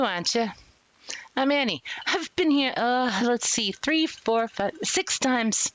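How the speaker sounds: put-on New York accent. Inmate Annie greets a newcomer in jail, bad New York accent. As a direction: play a chatty inmate in fake New York. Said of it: "New York accent, and a very badly emulated one".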